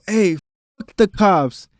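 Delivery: rowdy retold stage shout; retold yell into the mic. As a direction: call it out like you've grabbed the mic. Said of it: "why are you screaming this into the mic".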